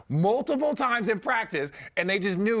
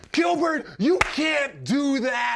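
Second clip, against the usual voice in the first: scolding shout; 7 semitones higher, 4 dB louder, slower than usual. Retold or imitated. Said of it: "So quick".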